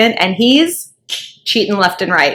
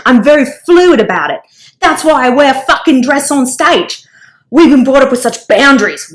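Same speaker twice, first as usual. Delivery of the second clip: Australian accent impression; 3 semitones higher than usual. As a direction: play the Aussie rocker, cheeky and blunt. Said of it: "I'm gonna do his accent".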